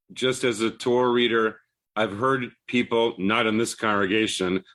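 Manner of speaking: ordinary talk, nothing marked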